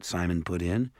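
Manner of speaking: plain reading voice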